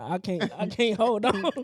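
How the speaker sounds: laughing mock cry of a slipping ponytail. Ponytail losing its grip, laughing mock whine. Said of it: "that mug just say".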